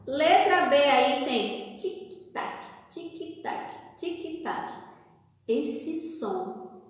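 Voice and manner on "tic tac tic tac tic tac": soft, crisp tick-tock repeats; quiet rhythmic tic-tac; clock ticking imitation. Shown as a demonstration. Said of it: "o som do relógio".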